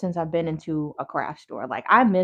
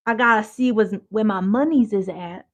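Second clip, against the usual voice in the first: lightly voiced old person, a bit louder; a hint of an elder's way of talking; old people talk; 2 semitones higher, 5 dB louder than usual. Checking her balance, like old folks talk. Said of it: "How old people be talking".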